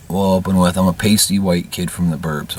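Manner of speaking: ordinary delivery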